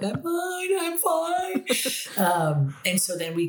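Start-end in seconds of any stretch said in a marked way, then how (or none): 0.0-1.6 much higher, squeezed-up I'm fine; tearful teen insisting. Retold or imitated. Teen me to mom after the concert, high and choked. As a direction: force it out high through tears. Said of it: "And I'm like".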